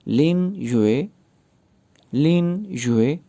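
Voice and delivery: लिन युए said very slowly, rising on लिन; slow name demo. Shown as a demonstration. Teacher teaching the name लिन युए, very slow. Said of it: "नामों को pronounce करना सीखते हैं".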